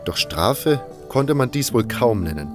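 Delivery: ordinary talk, nothing marked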